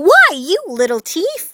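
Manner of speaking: high-pitched, outraged accusation; indignant cry. A narrator's reading. Drizella to Cinderella over the beads, crying indignantly. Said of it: "Drizella cried".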